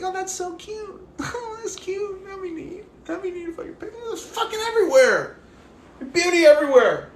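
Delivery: mimicking the stranger finally enjoying the daisies, cooing high; high delighted woman. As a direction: coo like a woman melting over flowers.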